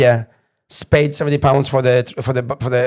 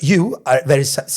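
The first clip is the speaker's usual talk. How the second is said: ordinary talk, nothing marked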